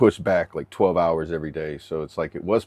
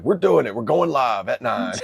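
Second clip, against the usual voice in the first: high, loud, slowing mock command; drill sergeant voice; 5 semitones higher, 4 dB louder than usual. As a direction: announce it like a drill instructor. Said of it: "It's like".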